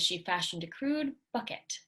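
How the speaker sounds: plain reading voice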